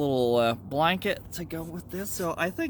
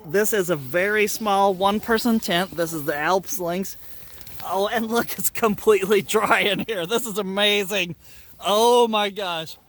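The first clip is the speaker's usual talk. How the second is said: excited; 3 semitones higher, 5 dB louder than usual. Real, not acted. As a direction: gush about the dry tent. Finds tent dry, thrilled.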